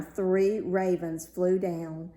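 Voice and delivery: plain reading voice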